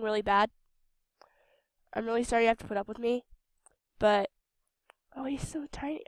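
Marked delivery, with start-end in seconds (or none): 5.2-6.0 soft, breathy whisper of delight; cooing whisper. Real, not acted. Gamer cooing at a tiny creature, whispered. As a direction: coo over it like a tiny pet.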